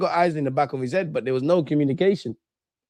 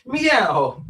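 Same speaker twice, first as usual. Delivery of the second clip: mock meow; 6 semitones higher than usual. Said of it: "why am I shouting at the cat?".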